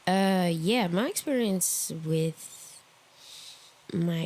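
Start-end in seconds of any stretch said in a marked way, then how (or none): none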